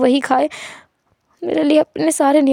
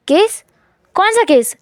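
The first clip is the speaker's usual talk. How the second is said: startled; 5 semitones higher, slower than usual. Acted. Wing shocked question.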